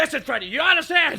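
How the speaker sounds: high, loud dramatic movie plea; urgent recruiter. Retold or imitated. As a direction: press him hard in mock urgency. Acted recruiter pressing Freddy.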